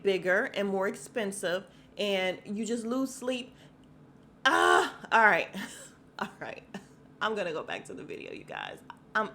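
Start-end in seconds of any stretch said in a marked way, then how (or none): 4.5-5.0 loud, high exasperated yell; frustrated cry. Real, not acted. Mom losing sleep over her kids, frustrated yell. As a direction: let out a big wordless burst of frustration.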